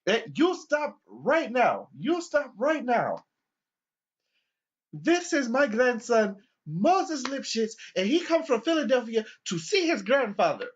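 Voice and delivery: put-on high, slow, loud old man voice; an old man scolding the cop, high and slow; old man stepping in. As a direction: play a fussy old grandpa defending his grandson. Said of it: "there was a senior citizen there, and he goes".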